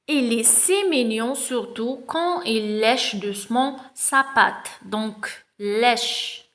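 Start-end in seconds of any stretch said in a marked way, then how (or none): 0.1-4.7 slow reading aloud. Shown as a demonstration. Teacher reading the text aloud to pupils, very slow. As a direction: read each word very slowly. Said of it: "On va commencer".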